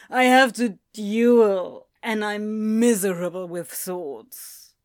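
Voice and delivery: slow, loud despairing whine; loud drunken wail. Acted. Demon wailing to the angel about the duel.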